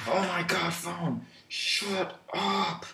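high, exasperated shout at phone; annoyed yell. Real, not acted. Phone interrupts the recording.

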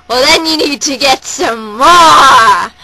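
loud, slow rallying shout; hammed-up loud slow party shout; rowdy crowd call. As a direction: whip the crowd up like a hype man.

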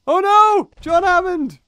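loud, high-pitched outburst of dismay; dismayed shout. Real, not acted. Gamer realising a mistake in the trade, real dismayed shouting. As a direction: cry out as it all goes wrong.

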